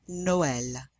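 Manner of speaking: "Noël" in two syllables, "no-el"; vowels pronounced separately. Shown as a demonstration. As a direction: keep the O and E apart in "Noël". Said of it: "these two vowels are pronounced separately".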